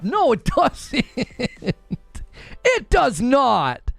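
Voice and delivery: slow laughing denial. Real, not acted. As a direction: laugh through a flat no. Laughing rebuttal of claims.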